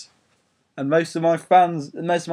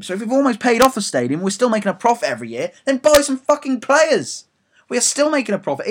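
building furious rant, louder and higher; 10 semitones higher, 7 dB louder than usual. Real, not acted. Fan raging at the club board, furious shout. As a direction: vent at the board, rising to a shout. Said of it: "I love ranting".

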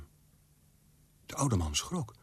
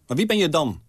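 sharp, loud and higher, an irritated demand; impatient snap; 8 semitones higher, 8 dB louder than usual. A narrator's reading. Old man snapping impatiently at the strange visitor. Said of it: "snauwde de oude man ongeduldig".

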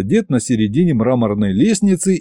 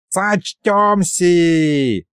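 gleeful yell; 7 semitones higher, much slower than usual. A narrator's reading. Old sorcerer rushes off with his gift, drawn-out gleeful holler. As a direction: stretch it out in mock-yelled glee. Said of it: "заорал осчастливленный маразматик".

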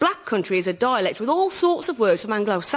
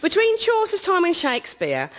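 ordinary delivery

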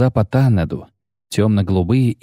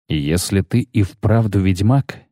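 ordinary delivery, nothing marked

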